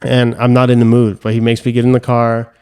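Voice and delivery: ordinary talk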